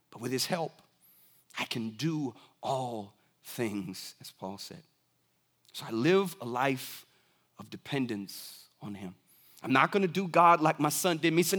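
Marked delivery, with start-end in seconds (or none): 0.1-9.1 hushed reflection. Real, not acted. Reflective close of sermon point.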